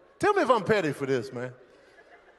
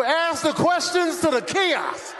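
higher, slow, drawn-out order; exasperated dismissal; 5 semitones higher, slower than usual. Acted. Agent cutting off a customer's second question, exasperated. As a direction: interrupt and spell it out slowly.

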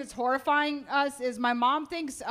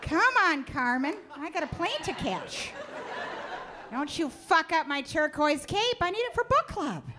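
higher, mock-bossy rich lady; mom voice; 7 semitones higher than usual. Mother hurrying a child weaver, higher and bossy. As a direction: hurry the child along in a prissy high voice.

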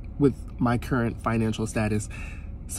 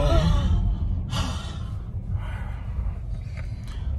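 excited gasp; 10 semitones higher than usual. Real, not acted. Excited about getting food.

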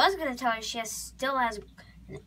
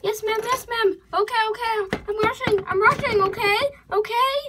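high, hurried repeated replies; panicked obedience; 8 semitones higher, 4 dB louder than usual. Acted. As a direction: scramble to obey before getting kicked out. Scolded horse hurries out.

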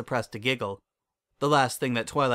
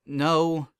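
higher, mildly moaned protest; moaning; 5 semitones higher, 4 dB louder than usual. A narrator's reading. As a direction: let out a dismayed moan on the word. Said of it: "Twilight moaned".

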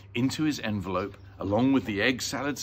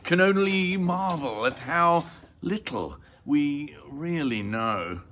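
higher, drawn-out character voice; doctor character; 6 semitones higher, 4 dB louder, slower than usual. Acted. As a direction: play the doctor, slow and high.

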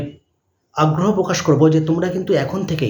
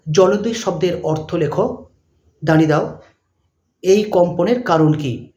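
dictating. Shown as a demonstration. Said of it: "dash দিয়ে লিখবে".

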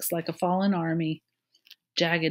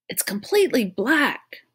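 raised, high-pitched report; calling out; 8 semitones higher, 5 dB louder than usual. A narrator's reading. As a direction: call it down from the shaft. Calls from inside dark shaft.

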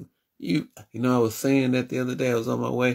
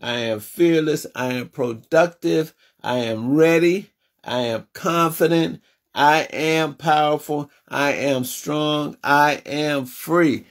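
preaching affirmations; 4 semitones higher than usual. Acted. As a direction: proclaim every I am to the congregation.

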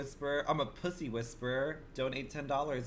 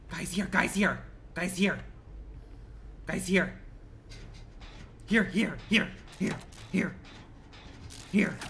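raised, higher calls to the team; calling out; 5 semitones higher than usual. Real, not acted. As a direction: call them over urgently. Calling teammates over in game.

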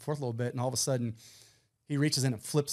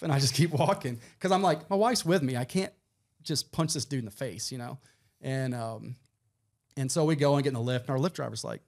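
ordinary delivery

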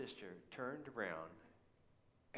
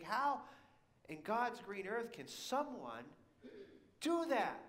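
appalled disbelief; 7 semitones higher, slower than usual. Retold or imitated. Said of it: "I think".

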